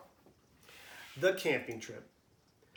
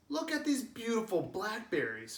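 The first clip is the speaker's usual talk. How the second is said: calling out; 7 semitones higher than usual. Acted. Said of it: "Father Mouse calls to Jason".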